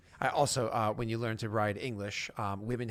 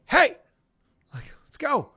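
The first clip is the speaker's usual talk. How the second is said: much higher, loud shout to the group; calling out; 12 dB louder, slower than usual. Acted. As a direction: yell at them to hurry up. Scout calls party to move.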